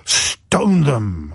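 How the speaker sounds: gasp, then drawn-out indignation; mock outrage. Patriot asked about lying foreigners, hammed-up outrage. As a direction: play the scandalised patriot, gasping first.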